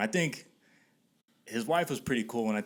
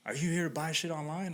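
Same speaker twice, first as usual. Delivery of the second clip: higher-pitched wary question; imitated suspicious homeowner; 7 semitones higher than usual. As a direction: question a stranger as an irked homeowner. Acting homeowner questioning him.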